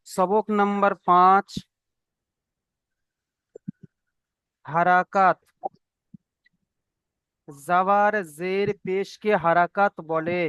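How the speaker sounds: drawn-out, measured read-aloud for repeating; slow lesson recitation. Shown as a demonstration. Teacher leading the class to repeat, slow recitation. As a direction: recite slowly, word by word. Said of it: "সাথে সাথে সবাই বলেন".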